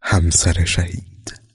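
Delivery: slow, measured reading of a chapter heading; chapter title announcement. A narrator's reading. Chapter heading read slowly.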